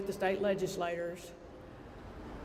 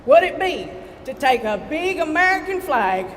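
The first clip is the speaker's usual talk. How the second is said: much higher and louder, whipping up the crowd; rally shout; 8 semitones higher, 7 dB louder than usual. Real, not acted. Urging Shelby Valley crowd to cheer for flag, rousing shout. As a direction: shout it out, get them cheering.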